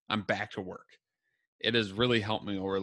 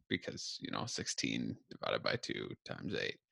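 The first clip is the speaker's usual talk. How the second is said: whispered aside to himself; muttering; 10 semitones lower, 8 dB quieter than usual. Real, not acted. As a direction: mutter the sum under breath.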